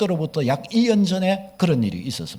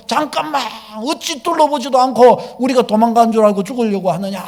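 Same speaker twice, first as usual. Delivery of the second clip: urgent calling out; 3 semitones higher, 4 dB louder than usual. Acted. Paul stopping the jailer from killing himself, urgent calling out. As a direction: call out to stop him at once.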